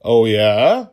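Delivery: loud mock defiance; playful challenge. Father answering his daughter's taunt, loud playful. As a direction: rise to the dare.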